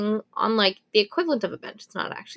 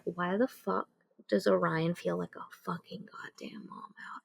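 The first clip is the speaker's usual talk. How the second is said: hushed, breathless whisper of disbelief; stunned whisper; 2 semitones lower, 11 dB quieter than usual. Retold or imitated. Elle asking herself why Orion feels like a Maw mouth, whispered. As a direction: drop to an unvoiced whisper, horrified. Stunned inner reaction in story.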